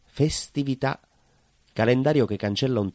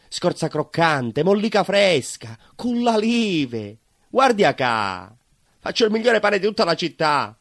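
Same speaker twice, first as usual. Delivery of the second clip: loud, high hawker's cry; proud baker shouting his wares to passers-by; street vendor calling; 8 semitones higher, 7 dB louder than usual. Acted. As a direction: call out your bread to the whole street. Baker hawking bread in the street.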